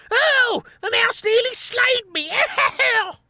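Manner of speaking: very high whiny rat howl; tearful howling. A narrator's reading. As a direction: howl like a crybaby. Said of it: "howling tearfully".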